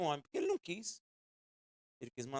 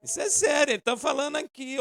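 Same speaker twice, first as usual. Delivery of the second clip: higher, louder insistence; real preaching shout; earnest shout; 9 semitones higher, 9 dB louder than usual. Real, not acted. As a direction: shout it to make them listen. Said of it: "vocês estão pensando que é brincadeira".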